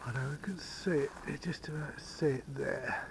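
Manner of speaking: soft unvoiced whisper. Real, not acted. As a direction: breathe the words softly. Whispering at the sign.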